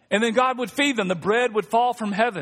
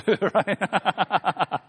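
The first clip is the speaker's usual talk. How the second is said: laughing. Real, not acted. Laughing aside.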